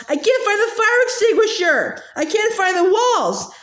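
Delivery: high, panicky calling out; lost and frightened, call for help; panicked yell. A narrator's reading. Boy lost in the mist cries out, high panicky call. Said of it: "Sammy yelled".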